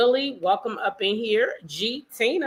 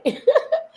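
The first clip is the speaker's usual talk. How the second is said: high laugh; 5 dB louder than usual. Real, not acted. Host laughs greeting viewers.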